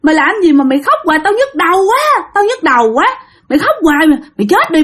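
high-pitched exasperated scolding; acted angry outburst, high but not shouted; cursing at a child. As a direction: snap at a crying child, pitch jumping up. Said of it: "cái chị mới chửi".

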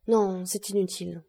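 ordinary delivery, nothing marked

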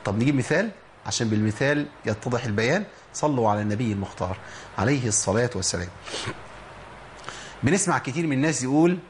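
normal talking voice

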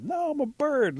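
very high, loud denial; insistent protest. Acted. Bat to the doubting birds, protesting high and loud.

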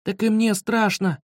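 high-pitched anxious complaint; scared retort. A narrator's reading. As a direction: protest that you are frightened too. Frightened retort to friend.

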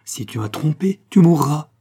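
hissed warning bursting loud at the end; brewing storm. A narrator's reading. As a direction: let the threat swell from a hiss to a cry. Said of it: "dont la voix couvait les tempêtes".

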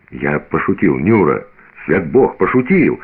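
usual speaking voice

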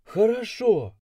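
high, loud annoyed answer; growled reply. A narrator's reading. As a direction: snap back with a light growl. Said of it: "прорычала она в ответ".

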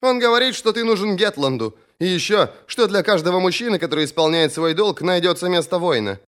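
high female character voice; earnest high sister's voice; sister relaying message. A narrator's reading. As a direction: pass on the message, pitched well up.

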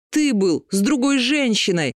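high accusing yell. A narrator's reading. Liliana accuses her husband of another woman, yelling. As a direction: throw the accusation at him, shouting. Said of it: "заорала Лилиана".

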